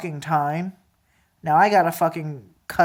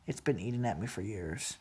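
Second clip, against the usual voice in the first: far softer and lower, almost gone; the repeat sinks to a hush; near-whispered hurt; 10 semitones lower, 18 dB quieter than usual. Real, not acted. Repeating to a relative the pain that festered, near whisper.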